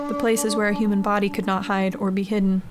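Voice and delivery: usual speaking voice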